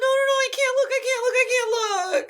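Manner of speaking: high laughing panic; mock panic. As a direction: beg not to look, laughing. Playing patient getting shot.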